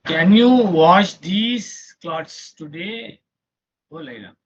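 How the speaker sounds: very slow, loud teaching read; slow model sentence. Shown as a demonstration. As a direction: spell it out slowly for the class. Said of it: "slow model sentence for students".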